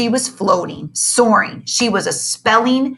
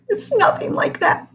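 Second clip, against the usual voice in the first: sniffling; 14 semitones higher than usual. Acted. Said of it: "sniffled Miss Turner".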